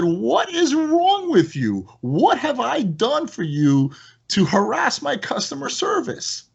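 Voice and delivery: high, slow playful telling-off; playful scold. Retold or imitated. Owner playfully scolding a customer who harasses his staff. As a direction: scold her playfully, drawn out. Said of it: "I said".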